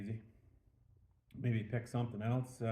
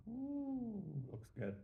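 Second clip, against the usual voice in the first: high, breathy ooh of delight; pleased little ooh; impressed ooh; 8 semitones higher, 9 dB quieter, slower than usual. Real, not acted. Tasting the beer, pleased.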